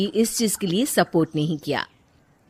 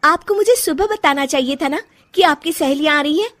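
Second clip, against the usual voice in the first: higher, louder, fast resentful retort; angry complaint; 8 semitones higher, 5 dB louder than usual. Acted. Rajni confronting her mother-in-law over the guests, angry and quick. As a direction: throw the complaint back at her.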